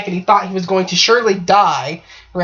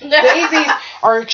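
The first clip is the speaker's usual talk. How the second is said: words bursting through a high laugh; 7 semitones higher, slower than usual. Real, not acted. Storyteller cracking up over the daisies, laughing. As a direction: talk through laughter, drawn out.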